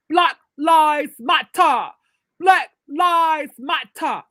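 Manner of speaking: imitated British crowd chant, each word drawn out; British accent. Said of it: "with that British accent".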